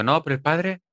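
lightly voiced cheesy screenwriter; a hint of a lazy script excusing the father; mock mediocre writer line. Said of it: "Un guionista mediocre habría dicho".

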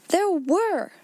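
high, emphatic, falling assertion; stout insistence. A narrator's reading. Ermengarde repeats her claim, stoutly insistent. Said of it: "she said stoutly".

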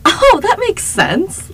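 high, loud, slow mock realization; tipsy agreement. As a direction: play up a tipsy, easily convinced reaction. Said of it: "I've already had, like, a drink or two".

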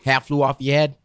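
imitating the guest's Boston accent; Boston accent attempt. Shown as a demonstration. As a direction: try out a Boston accent on the phrase. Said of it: "Am I getting it? The accent".

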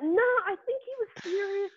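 high-pitched mock protest; insistent protest. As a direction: squeak out a defensive denial. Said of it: "I was like".

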